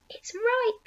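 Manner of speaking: high-pitched joyful exclamation; delighted cry. A narrator's reading. Aunt touching the peach, high delighted cry. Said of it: "she cried".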